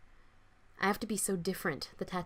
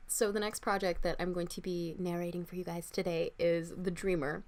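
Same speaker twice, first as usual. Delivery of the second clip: usual speaking voice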